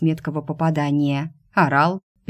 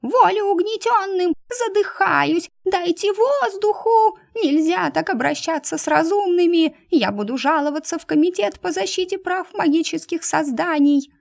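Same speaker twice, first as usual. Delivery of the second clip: hysterical mouse protest; 13 semitones higher than usual. Acted. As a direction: overact the suffocation, squeaking. Frozen mouse dramatically protests.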